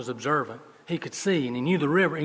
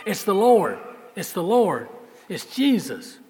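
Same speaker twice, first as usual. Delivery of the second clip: higher, much louder excited exclamation; excited cry; 6 semitones higher, 7 dB louder, slower than usual. Acted. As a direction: shout the recognition with joy. John cries out recognizing Jesus.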